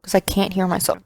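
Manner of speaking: talking loud without hearing myself. Real, not acted. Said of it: "I'm really fucking loud".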